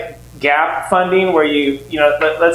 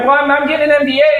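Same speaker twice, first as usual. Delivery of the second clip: eager defense; 7 semitones higher, faster than usual. Retold or imitated. Son defending himself to dad.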